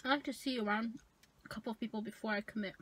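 muffled behind hand; talking while chewing; hand over mouth. Real, not acted. Seen 0: Hand held over mouth throughout, just after biting burger.

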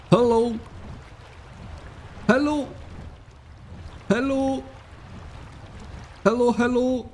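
bright high-pitched greetings to a stranger; high friendly hello. Real, not acted. Player calling hello to a stranger in game, high.

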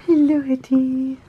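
high, slow cooing. Real, not acted. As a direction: coo the cat's name slowly. Cooing to the cat.

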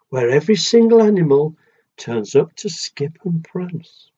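gentle rhythmic read-aloud; storybook reading. A narrator's reading. Parent reading a picture book to a child, sing-song rhyme.

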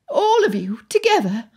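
high-pitched disbelief; pressing. A narrator's reading. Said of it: "Anne pressed".

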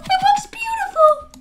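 very high, tearful-sounding squeal; high gushing. Pretend. Player admiring glowing dark armor, very high gushing squeal. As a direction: squeal it as if overcome.

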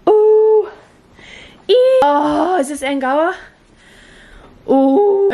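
high, loud thrilled cooing; delighted squeals. Real, not acted. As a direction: squeal with delight at each thing. Raw reaction to the room.

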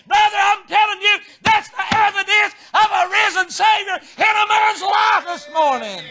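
high, driving revival sermon; fervent preaching. Real, not acted. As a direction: build to a long shouted climax. Preaches at full force.

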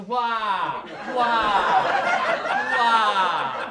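loud, high wailing cries; kids wailing. Retold or imitated. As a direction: wail it like a horrified child. Horrified kids crying out.

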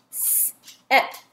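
"S-et" with a long isolated s; sounded out. Shown as a demonstration. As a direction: tap out "S-et" as separate sounds.

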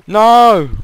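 a sudden, high, loud cry of defeat; frustrated yell. Real, not acted. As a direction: yell as you fall off the jump. Falls right after boasting.

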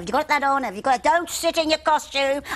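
high, loud mock scolding; strict dresser yelling. Wardrobe mistress nagging the child actress, loud and high. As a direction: bark at the young girl like a fussy dresser. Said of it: "scream and shout".

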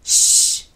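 loud shush. A narrator's reading. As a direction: hush the interrupter. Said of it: "said Kojo".

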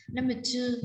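usual speaking voice

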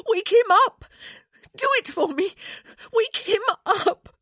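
shrieked, tearful plea at very high pitch; desperate crying. A narrator's reading. Wife pleading beside her lifeless husband, crying out frantically. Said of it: "she cried".

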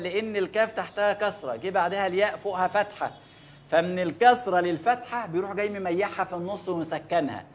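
ordinary talk

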